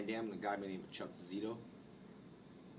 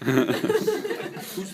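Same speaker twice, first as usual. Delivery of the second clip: laughing; 14 dB louder than usual. Retold or imitated. Joking about cops' suspicion.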